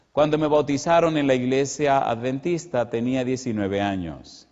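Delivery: ordinary talk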